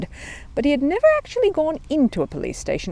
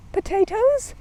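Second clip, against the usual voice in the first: high puzzled echo; surprised echo; slower than usual. Acted. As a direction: echo it back, baffled. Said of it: "echoed the policeman".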